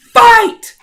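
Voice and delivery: explosive, high-pitched shout; announcer's fight shout. Acted. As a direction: launch the duel like a fight announcer. Game master starts the duel.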